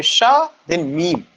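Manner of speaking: "श", pause, then "मीम"; syllable by syllable. Shown as a demonstration. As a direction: split Shamim into two separate beats, "श" and "मीम". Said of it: "जब आप pronounce करेंगे". ("श" और "मीम" को रुककर अलग-अलग बोलें)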